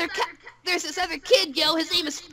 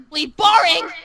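heckling shout; 8 dB louder than usual. Acted. Heckling the story just read.

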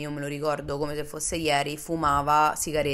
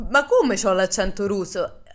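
slow, louder offended question; indignant surprise; 4 semitones higher, 7 dB louder than usual. Retold or imitated. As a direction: ask it baffled and a bit offended.

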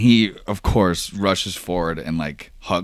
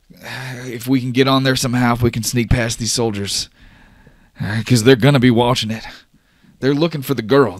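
higher, stumbling, urgent explanation; nervous rambling in a raised pitch; anxious, flustered Oscar; 7 semitones higher than usual. Acted. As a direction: think out an escape plan under pressure. Plays Oscar planning an escape.

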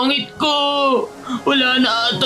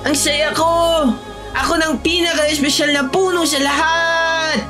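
excited; 5 semitones higher than usual. Acted. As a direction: cheer with delight, pitched high. Tree rejoices over gold leaves.

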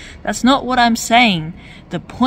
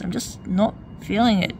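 ordinary delivery

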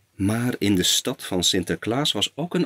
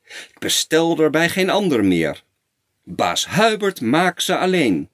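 higher, mildly exclaimed quote; storyteller's lightly raised cry of the crowd; crowd's cry; 6 semitones higher than usual. A narrator's reading. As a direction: voice the townsfolk calling out, lifted in pitch. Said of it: "riep elk een".

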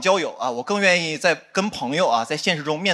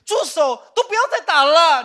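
mock call to stop; 8 semitones higher, 4 dB louder than usual. Imagined fight, calling to stop.

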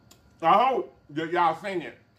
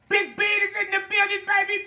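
hype shout; 14 semitones higher than usual. Acted. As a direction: announce it like a hype man. Hype shout announcing the guest.

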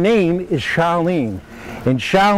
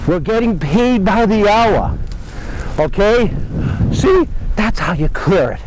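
higher-pitched playful rallying of a lazy crew; mock foreman; 5 semitones higher than usual. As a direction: chivvy them along like a pretend boss. Playfully rousing goats as workers.

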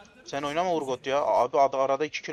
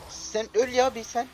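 angry; 12 semitones higher, 5 dB louder, slower than usual. Real, not acted. Cursing enemy champion in game.

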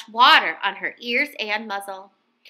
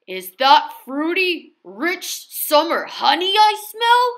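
louder, curious sniff; hungry bear, eager; eager Mister Bear; 5 semitones higher, 4 dB louder than usual. A narrator's reading.

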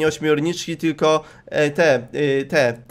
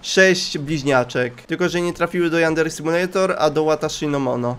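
usual speaking voice

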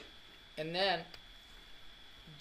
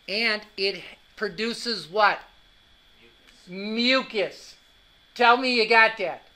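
much louder, driving lecture delivery; loud emphatic teaching; 3 semitones higher, 10 dB louder than usual. Real, not acted. Teacher educates the class for real, loud and emphatic. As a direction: belt out the lesson to the whole room.

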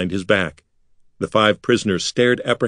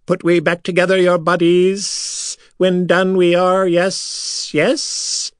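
high, strange alien voice; high creature voice; 9 semitones higher, slower than usual. A narrator's reading. Silver Sand leader promising to reassemble prisoners.

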